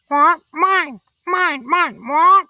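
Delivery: high, loud squawking parrot voice; a greedy parrot squawking mine. A narrator's reading. Parrot voice held.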